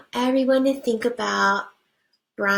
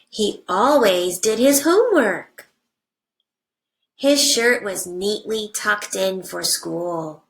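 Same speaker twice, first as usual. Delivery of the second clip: put-on reading to children. Teacher reading a picture book to her class, animated storybook voice.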